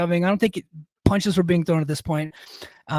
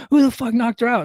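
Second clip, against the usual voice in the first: raised, indignant yell of disbelief; angry demand; 6 semitones higher than usual. Retold or imitated. To friend after hearing his wife was knocked out, raised angry demand. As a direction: demand to know who hurt her.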